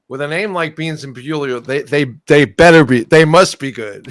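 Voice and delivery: loud, slowing into must be good; hyped about the band name; excited. Retold or imitated. Excited inner thought, retold.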